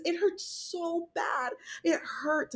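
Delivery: high, soft, slow and near tears; tearful. Real, not acted. Mother recalling the surgery pain, high and tearful. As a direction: let the pain break through.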